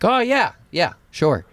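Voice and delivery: high-pitched, breezy agreement; mock a friend agreeing too easily. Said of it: "And he's like".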